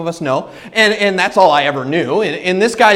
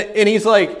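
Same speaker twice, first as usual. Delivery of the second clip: usual speaking voice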